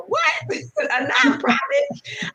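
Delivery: high, slow, laughing disbelief; a giggle of shock at the idea. Retold or imitated. Telling the Lord a nonprofit is absurd, laughing in disbelief. Incredulous reaction to God's call.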